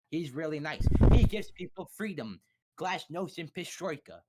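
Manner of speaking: excited praise. Real, not acted. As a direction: cheer him on loud and proud.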